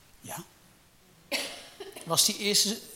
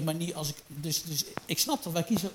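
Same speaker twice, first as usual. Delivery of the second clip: usual speaking voice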